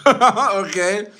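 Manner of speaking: high laugh running into the word. Real, not acted. Laughing at the commenter.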